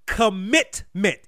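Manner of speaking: "commitment" drawn out slowly, falling at the end; emphasis on commitment. Shown as a demonstration. Host stressing to listeners that it's only "commitment", slow and weighted. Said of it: "I'm gonna emphasize the word".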